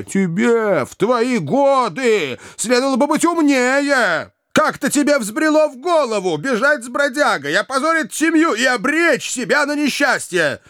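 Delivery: high, relentless tirade; enraged brother scolding his sister; furious scolding. A narrator's reading. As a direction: berate her and bark orders at a high pitch. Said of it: "неистовый".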